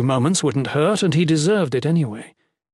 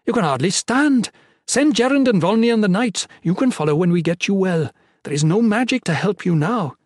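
firm healer; 7 semitones higher, 3 dB louder than usual. A narrator's reading. Healer scolding wounded lord.

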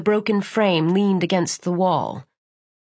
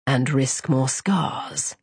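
low, very slow sneer; menacing villain; 6 semitones lower, much slower than usual. Acted. Villain mocks.

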